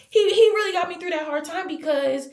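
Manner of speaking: real emotion rising, pitched high; getting emotional. Real, not acted. Vlogger thanking God for getting through a hard time, emotional. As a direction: voice climbs as feelings well up. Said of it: "here I go getting emotional".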